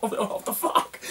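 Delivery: whispered in disbelief through laughter; stunned laughing whisper. Retold or imitated. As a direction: breathe it out, half laughing, shocked. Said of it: "I'm like".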